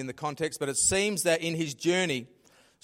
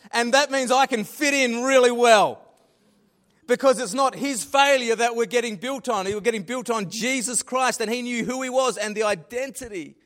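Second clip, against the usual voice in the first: higher and louder, caught up in joy; excited preaching; 6 semitones higher, 5 dB louder than usual. Real, not acted. Preacher to congregation about church on failure, excitedly loud. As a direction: swept up, preaching with rising excitement. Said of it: "Oh, I'm getting excited about that".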